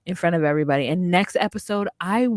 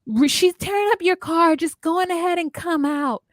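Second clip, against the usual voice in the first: much higher, pleading call; mock friend calling out; 11 semitones higher than usual. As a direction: play Renee coaxing him out. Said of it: "Renee's like".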